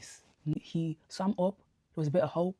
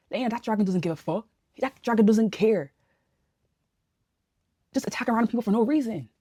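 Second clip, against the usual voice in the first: exasperated outburst; 5 semitones higher, 6 dB louder than usual. Real, not acted. Exasperated rant at character.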